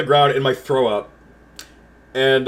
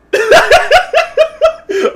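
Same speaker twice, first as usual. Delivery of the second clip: high, loud cackling burst; loud laughter; 7 dB louder than usual. Real, not acted. Laughing at having thrown up on Sam, cracking up. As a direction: crack up helplessly at your own story.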